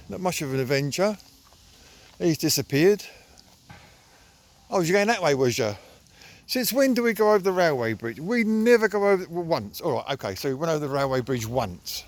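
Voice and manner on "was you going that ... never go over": usual speaking voice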